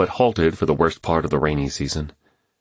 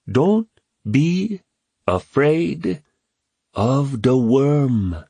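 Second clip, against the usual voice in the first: high and very slow, word by word; solemn boy's proverb; 8 semitones higher, much slower than usual. A narrator's reading. Kwame composing himself to tell James, slow solemn boy's voice. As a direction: deliver it like a solemn saying.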